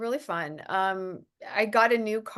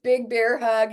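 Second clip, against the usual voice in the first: usual speaking voice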